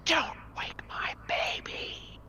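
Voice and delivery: high, slow spectral whisper; ghostly whisper. Acted. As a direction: breathe it out like a drifting ghost. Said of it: "she says to you as she reaches out".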